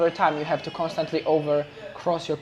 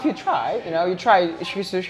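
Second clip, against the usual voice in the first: ordinary delivery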